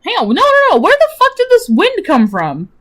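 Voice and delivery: high, alarmed; high startled disbelief; real alarm. Real, not acted. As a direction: panic at the noise. Startled by sudden wind.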